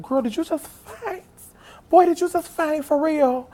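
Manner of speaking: very high, squeaky questioning; mock disbelief. Fan picking herself up after fainting, high disbelieving squeal. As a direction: squeal it as incredulous self-talk.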